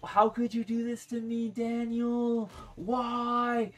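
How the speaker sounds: high, loud mock heartbreak; slow, melodramatic imitation of pleading; jilted-lover driver. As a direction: wail it like a dumped partner. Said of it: "like we were in a relationship for years".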